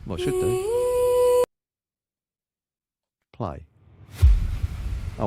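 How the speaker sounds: ordinary delivery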